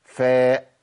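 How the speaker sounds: فاء with a firm lip-teeth f. Shown as a demonstration. Teacher modelling a firm f. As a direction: press firmly into فاء. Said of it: "لازم أقولها كده".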